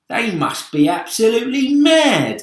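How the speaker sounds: a brash bug mocking the danger; cocky centipede. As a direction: centipede voice, scornful. Centipede voiced in story.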